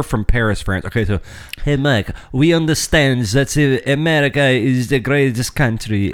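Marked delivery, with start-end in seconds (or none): 1.6-6.1 slow, hammed-up French accent; drawn-out fake French accent; bad French accent. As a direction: read it slowly in a terrible mock French accent. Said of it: "that's the worst French accent".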